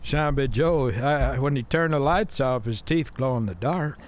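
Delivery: put-on folksy Southern old-timer; Southern accent. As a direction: spin it out in a Southern twang. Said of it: "You did practice that voice".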